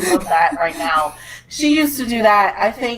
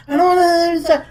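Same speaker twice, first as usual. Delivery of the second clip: high-pitched mock-kid protest; imitated daughter; 8 semitones higher, 4 dB louder, slower than usual. Daughter admits to mother she doesn't understand, high and embarrassed. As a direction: put on a child's squirming reply.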